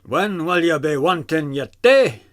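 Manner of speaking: general Scottish lilt; mild Scottish accent. Shown as a demonstration. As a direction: ask it in a light Scots voice. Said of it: "Or a general Scottish".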